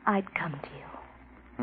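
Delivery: soft breathy whisper. Acted. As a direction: whisper it close and secret. Intimate promise to lover.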